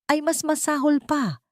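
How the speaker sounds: usual speaking voice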